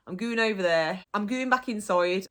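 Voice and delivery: GOING as "gooin", with an "oo". Shown as a demonstration. Teacher gives own everyday examples, GOING said "goo-in". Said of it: "gooing just means".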